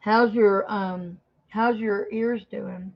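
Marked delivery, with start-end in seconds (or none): none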